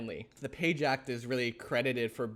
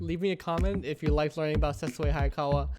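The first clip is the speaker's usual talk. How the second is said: upbeat, louder sign-off pitch; outro announcer read; 3 semitones higher, 5 dB louder than usual. Acted. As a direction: pump up the outro promo.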